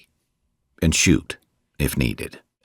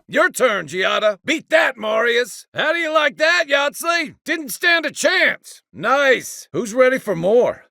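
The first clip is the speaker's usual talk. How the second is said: combat barks. Acted. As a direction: bark it across the battlefield. Victory and team barks.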